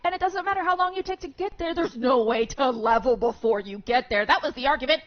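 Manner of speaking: loud, fast, worked-up rant; heated rant. Real, not acted. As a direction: push the argument hard. Heated rant about the game.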